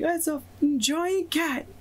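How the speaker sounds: high, drawn-out skeleton's cry without full volume; mild mock scream. Game master voices the screaming skeleton, mild mock scream. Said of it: "the skeleton just screaming".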